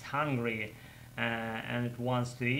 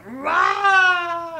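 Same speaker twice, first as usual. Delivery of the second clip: very high, loud, long scream; full-volume drawn-out "Rah"; imitated scream; 12 dB louder than usual. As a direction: scream as if a snake hit your face. Imitates man hit by flying snake.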